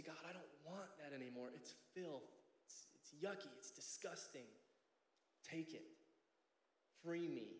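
softly beg, as if mildly crushed; broken, devastated prayer. Acted. Broken sinner confessing to God, quiet devastated plea. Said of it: "you're devastated".

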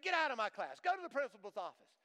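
teacher throwing a student out, loud mock anger; angry teacher. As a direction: bark it like a fed-up teacher ordering him out. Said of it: "And she said".

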